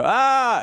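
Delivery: high, loud scream of agony; Chris Rock. Acted. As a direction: burst into a hammed-up scream of pain. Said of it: "And then Chris Rock was also".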